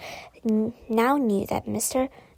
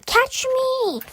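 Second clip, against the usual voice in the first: very high, strained plea; calling out in panic; 14 semitones higher than usual. A narrator's reading. Teacher calling to the kids below as he falls. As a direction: yell down for help while falling. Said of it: "he called out to the kids below".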